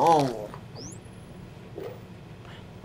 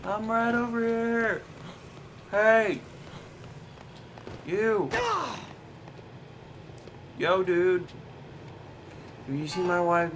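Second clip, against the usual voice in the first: raised, put-on character voice; game character; 4 semitones higher, 5 dB quieter than usual. Game character asks after wife Natalie, higher put-on voice. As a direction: pitch it up as a man calling out in a game.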